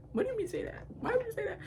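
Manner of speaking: tiny, high, hushed voice; small squeaky under-the-breath question; baffled aside. Retold or imitated. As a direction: ask it very softly and high, in disbelief. Stunned aside at the threat.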